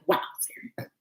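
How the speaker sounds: breathy imagined audience whisper; soft teasing whisper from fans; whispered mock viewers. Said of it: "you guys are gonna be like".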